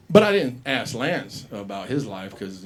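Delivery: normal talking voice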